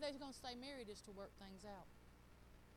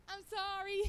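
mock laughing sorry; 7 semitones higher, 6 dB louder, slower than usual. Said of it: "I could just see Scotty saying".

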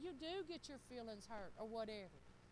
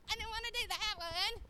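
mock whiny kid; 8 semitones higher, 10 dB louder than usual. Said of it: "He's like".

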